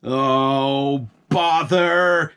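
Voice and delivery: a shouted, fed-up bother; grumbling farmer. A narrator's reading. Farmer Palmer grumbling at the spilled pots, shouted bother. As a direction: farmer groaning at the mess. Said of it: "said Farmer Palmer".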